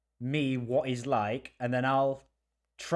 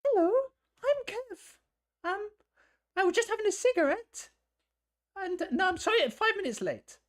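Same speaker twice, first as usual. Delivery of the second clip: very high, squeaky put-on voice; Kev. Kev apologising to the boss for lateness, meek falsetto. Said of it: "Can you change your accent?".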